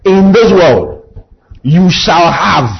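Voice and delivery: In this world, you shall have, drawn out slow; slow emphatic preaching. Shown as a demonstration. Preacher slowing down so the church can repeat it. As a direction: let each word land for the congregation. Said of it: "Tell your neighbor".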